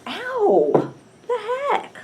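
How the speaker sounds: high, sharp cry of pain; sudden yelp of pain. Real, not acted. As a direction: yelp as the bite hits. Bitten by ants.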